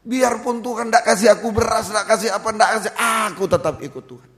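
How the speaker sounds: loud, high, insistent pledge; re-enacted cried vow. Preacher re-enacts vowing to follow God regardless, loud impassioned cry. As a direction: pile up the phrases and cry out the promise. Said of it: "Apa yang saya teriak pertama?".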